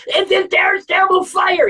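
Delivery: very high-pitched mock panic; worked up. Onlooker seeing the oven, all worked up. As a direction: cry out in hammed-up alarm. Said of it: "what are you getting all worked up about".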